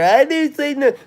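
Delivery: high, put-on innocent voice imitating her; mock denial. Mocking a friend who denies gossiping, high, put-on innocent voice. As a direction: mimic her playing dumb. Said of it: "but you wanna sit there".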